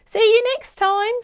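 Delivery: put-on high, loud, cheerful old lady voice; old lady calling goodbye to customers; croaky old lady. A narrator's reading. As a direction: call out goodbye like a chirpy old shopkeeper. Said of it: "with a croaky voice".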